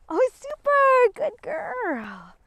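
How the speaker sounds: very high, drawn-out praise; delighted dog praise. Real, not acted. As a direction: coo high and slow, rewarding the dog. Praising the dog while training.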